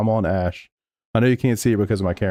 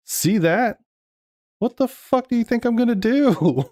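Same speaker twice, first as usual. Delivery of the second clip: real disbelief at the car ahead; exasperated outburst; 12 semitones higher than usual. Real, not acted. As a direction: yell at the screen in disbelief. Angry at another racer's braking.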